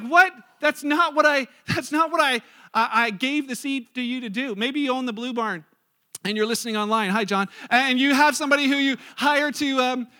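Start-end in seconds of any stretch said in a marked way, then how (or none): 0.0-4.5 loud, high, stammering disbelief; outraged farmer. Acted. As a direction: explode in acted indignation. Acted farmer outraged at misused seed.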